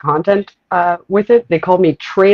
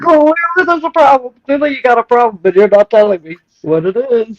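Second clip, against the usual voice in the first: high, acted-out exasperation; exasperated confrontation; 7 semitones higher than usual. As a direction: plead in mock frustration for an answer. Acts out arguing with passive-aggressive women.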